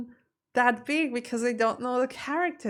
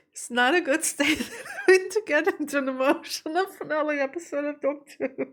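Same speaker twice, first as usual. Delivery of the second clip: higher, laughing and choked up; laughing through tears; 5 semitones higher than usual. Real, not acted. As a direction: laugh it off while about to cry. Laughing while near tears.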